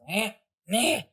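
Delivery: high, dazed little groans; groggy creature groans. Acted. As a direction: shake off the knockout with a whimper. Bowser Junior waking groggy.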